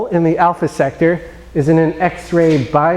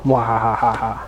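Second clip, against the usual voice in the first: evil laugh; 5 semitones lower, 4 dB quieter, slower than usual. Acted. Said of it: "He says".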